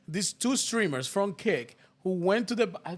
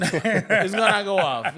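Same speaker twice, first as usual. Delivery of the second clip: slow, said through laughter; chuckling at what's coming; laughing as he predicts a rant; 4 dB louder, slower than usual. Real, not acted. Laughing about colleague.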